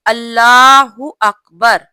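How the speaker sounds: अल्लाहू अकबर drawn out slowly and loud; slow clear takbir. Shown as a demonstration. Teacher shows her sisters the takbir, slow and clear. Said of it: "नमाज में देखिए".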